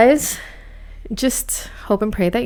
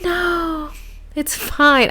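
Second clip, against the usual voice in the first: high protesting denial; 7 semitones higher, much slower than usual. Retold or imitated. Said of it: "I'm like".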